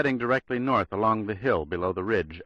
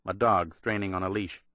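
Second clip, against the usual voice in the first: ordinary delivery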